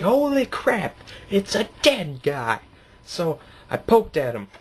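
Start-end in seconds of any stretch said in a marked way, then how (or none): 0.0-2.6 high yelp, then a slow stunned voice; mock horror at finding a body; shocked outburst. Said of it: "and I was like".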